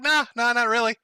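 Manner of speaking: high, airy brush-off; lightly voiced shrug of a reply; mock casual denial. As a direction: wave it away like it's nothing. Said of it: "You're like".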